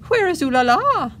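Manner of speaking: high, loud, frantic cry of a poodle mother. A narrator's reading. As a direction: panic for the lost puppy. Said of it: "asked frantically".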